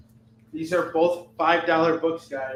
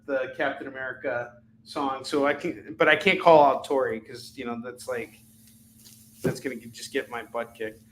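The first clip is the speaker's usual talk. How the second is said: ordinary delivery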